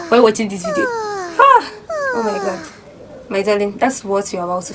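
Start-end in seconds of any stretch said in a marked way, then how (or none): 0.9-2.7 high yawn running into words; mock yawn. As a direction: yawn through the exclamation. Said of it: "Have you ever yawned like that?".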